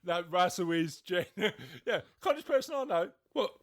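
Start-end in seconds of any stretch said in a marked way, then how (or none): 1.9-3.1 quick, high, louder hammed-up brag; mock smug boast. Host keeps mocking Russell's self-praise, quick high brag. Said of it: "modestly went". 3.3-3.5 mock indignant. Mock Russell defending himself.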